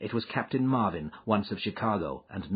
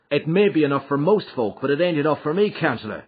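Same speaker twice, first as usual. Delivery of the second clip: boldly, higher and louder; 7 semitones higher, 8 dB louder than usual. Acted. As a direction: stand up to the boss. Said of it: "said McMurdo boldly".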